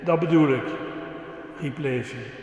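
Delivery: put-on lively storytelling for the kids; reading to children. Preacher telling the children a dog story, lively. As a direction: tell it like a children's story with voices.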